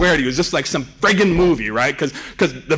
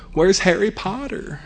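put-on higher, slow child's question; little daughter; 3 semitones higher, slower than usual. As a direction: imitate a child asking plaintively. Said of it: "my daughter says".

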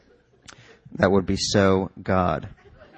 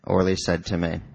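plain reading voice

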